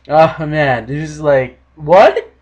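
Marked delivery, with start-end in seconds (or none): none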